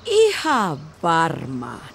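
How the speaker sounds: slow, drawn-out sarcastic reply; sarcastic grimacing reply; grimacing sarcasm. Acted. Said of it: "Elisa irvisti".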